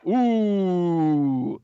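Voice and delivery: long, loud wincing "ooh"; a crowd reacting to a nasty blow; crowd's "ooh". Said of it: "the sound of a".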